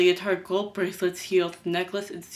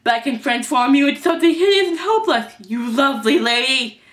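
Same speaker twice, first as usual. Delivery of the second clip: loud, higher-pitched mocking threat; very sarcastic; 6 semitones higher, 6 dB louder than usual. A narrator's reading. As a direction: threaten her with mock flattery. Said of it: "said lovely very sarcastically".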